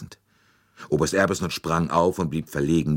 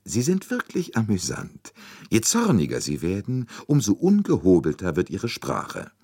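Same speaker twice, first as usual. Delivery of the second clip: higher, lilting relish; amused; 6 semitones higher, 3 dB louder than usual. A narrator's reading. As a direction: delight in their bad temper. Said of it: "meinte Poirot vergnügt".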